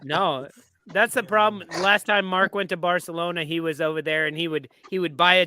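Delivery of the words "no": normal talking voice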